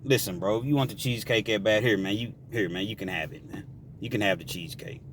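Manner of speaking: low and soft, backing down gently; a softly spoken peacekeeper; calm, soft plea. Retold or imitated. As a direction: talk him down quietly, hands up. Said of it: "John's trying to avoid the whole confrontation".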